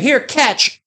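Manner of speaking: high teasing call; mocking toss. Throwing loose granola at cyclists with a high mocking call. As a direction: sneer as you throw granola. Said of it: "I'd be like".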